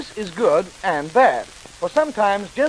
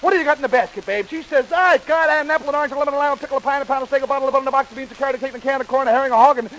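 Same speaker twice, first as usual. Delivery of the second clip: high rapid-fire list patter; as fast as possible; 4 semitones higher, faster than usual. Shown as a demonstration. Storyteller racing through the basket's contents, rapid-fire. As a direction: reel the whole list off at speed. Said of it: "just as fast as I can tell it".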